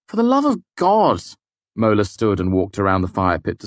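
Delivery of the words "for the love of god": much higher and louder exclamation; exasperated cry. A narrator's reading. Mola losing patience with the boys, high loud cry. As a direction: throw up your hands at them.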